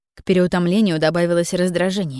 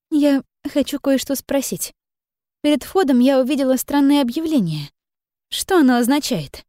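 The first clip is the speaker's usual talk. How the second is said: put-on hesitant young woman; 7 semitones higher than usual. Son Mi asks hesitantly.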